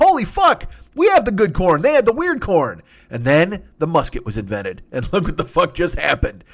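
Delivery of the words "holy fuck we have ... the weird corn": high, loud hammed-up discovery; mock amazement. Pilgrims marvelling at their own corn, high loud mock excitement. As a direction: burst out as if striking gold.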